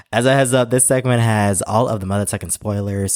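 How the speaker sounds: ordinary delivery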